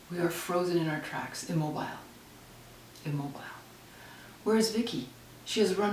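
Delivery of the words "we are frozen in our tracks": plain reading voice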